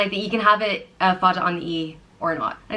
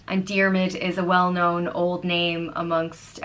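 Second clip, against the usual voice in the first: ordinary talk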